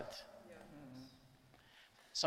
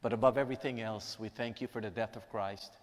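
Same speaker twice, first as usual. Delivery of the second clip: soft, reverent prayer voice; 4 semitones lower, 5 dB quieter than usual. Acted. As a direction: pray quietly to God for the room. Prayer continues.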